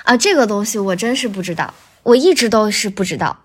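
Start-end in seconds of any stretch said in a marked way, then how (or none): none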